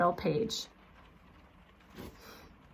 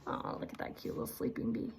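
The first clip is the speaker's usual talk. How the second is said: soft, quick whisper; hushed aside; 3 semitones higher, 12 dB quieter, much faster than usual. Real, not acted. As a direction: whisper so the bee stays asleep. Aside to child about picture.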